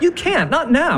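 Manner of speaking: high, frantic objection; hysterical. Acted. Husband forbidding wife to go to bed, frantic. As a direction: plead and forbid, pitch up. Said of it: "when you're hysterical".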